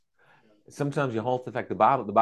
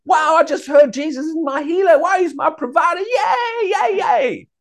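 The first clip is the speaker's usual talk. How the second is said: squealing delight; 7 dB louder than usual. Retold or imitated. Acting out a glad new believer.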